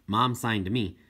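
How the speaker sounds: usual speaking voice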